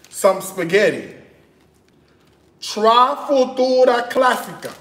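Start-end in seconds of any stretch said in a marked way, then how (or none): 2.6-4.7 higher-pitched mock Italian, Spanish-tinged; Italian with Spanish accent. Reading a spaghetti packet to viewers, Spanish-accented Italian. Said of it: "I'm speaking Italian with a Spanish accent".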